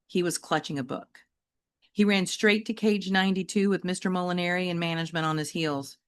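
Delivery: ordinary delivery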